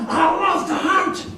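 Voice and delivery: high, drawn-out whoop of joy; exultant cry. Retold or imitated. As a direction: stretch it out high and gleeful. Exclaiming joy of the hunt.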